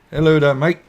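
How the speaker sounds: loud, high, hammed-up British accent; bad British accent. Streamer greets a chat viewer in a mock British accent. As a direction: greet him like a cheery Brit, badly overdone British accent. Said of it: "that's a horrible British accent on my part".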